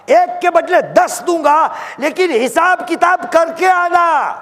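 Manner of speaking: high, ringing sermon proclamation; sustained high-pitched exhortation; impassioned preaching. Acted. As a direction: preach it high and forceful as a divine summons. Preaches at full force.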